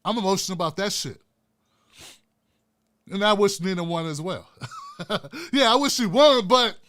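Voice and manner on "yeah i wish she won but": laughing. Real, not acted. Laughs mid-rant.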